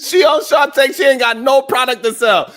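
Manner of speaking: excited laughing shout; loud laughing outburst. Real, not acted. Laughing exclaim.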